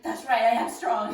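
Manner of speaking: loud, slow hammed-up affirmation; self-pep voice. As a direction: puff up like a pep talk.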